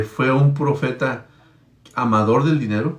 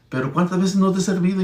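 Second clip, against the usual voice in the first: talking donkey; 6 semitones higher than usual. Retold or imitated. Donkey answers the beating prophet.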